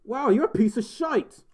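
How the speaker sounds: high, exaggerated Australian accent; playful insult in fake Aussie; bad Aussie accent. Shown as a demonstration. Streamer teasing a viewer, hammed-up Aussie accent. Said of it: "your best, best Aussie accent".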